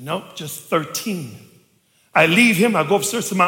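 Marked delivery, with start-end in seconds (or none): 0.0-1.4 low, soft and very slow; subdued bad news. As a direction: break it gently, as the reluctant accountant. Accountant breaks the bad news.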